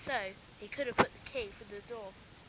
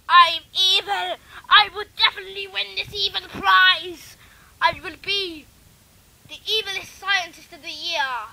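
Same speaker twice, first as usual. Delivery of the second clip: loud, high, hammed-up evil boast; gloating villain; 9 semitones higher, 17 dB louder than usual. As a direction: crow it like a mad scientist who thinks he's won. Villain boasts about evil prize.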